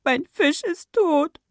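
put-on high, slow wail of a crying child; acted heartbroken little-boy sobbing voice; tearful. A narrator's reading. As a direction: force it out through tears, drawn out. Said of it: "stieß er unter Tränen hervor".

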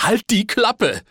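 slow, high-pitched angry yell; exasperated shout. A narrator's reading. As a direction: shout the other person down. Nephew shouts aunt down.

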